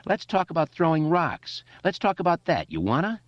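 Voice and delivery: high-pitched, goading challenge; jeering bully voice; bullying taunt. A narrator's reading. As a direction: press him for a fight. Bully threatens Eddie.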